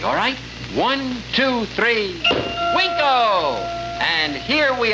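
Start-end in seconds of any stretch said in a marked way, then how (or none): 0.7-3.6 counting down, then the magic word with a flourish; kids party magician. Acted. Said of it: "I want you to say the magic word with me".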